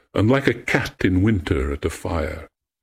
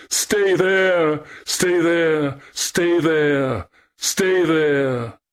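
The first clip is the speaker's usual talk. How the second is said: high, drawn-out plaintive cry; wailing echo; 10 semitones higher, much slower than usual. A narrator's reading. Nymph crying back his unwelcome words, high echoing wail. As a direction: wail it back, sinking slightly with each repeat. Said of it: "weeping to utter it".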